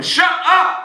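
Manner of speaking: sudden loud, high yell; shouted command. Shown as a demonstration. Hypnotist showing volunteer how to silence laughers, shouted command. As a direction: cut them off at full volume.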